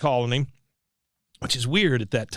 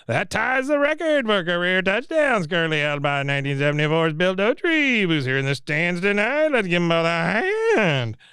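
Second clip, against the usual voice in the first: higher, booming stadium announcer voice; 5 semitones higher than usual. Retold or imitated. Announcer tells the crowd about Bill's record: higher PA voice. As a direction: read it like the stadium PA. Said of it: "you hear the announcer again say".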